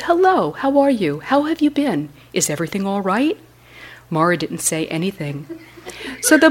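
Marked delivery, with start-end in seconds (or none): 0.0-3.4 joyful greeting. A narrator's reading. Buddha greets old friend warmly.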